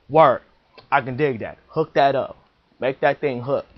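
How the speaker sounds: pushy customer. Acted. Customer eagerly answering the ball driller, loud street style. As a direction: hype the customer up, hook that up.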